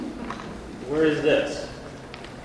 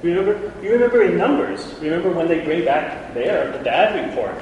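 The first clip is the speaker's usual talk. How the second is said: normal talking voice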